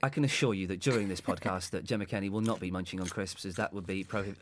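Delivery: low, hushed official tone; pompous announcer, kept low; mock formal announcement.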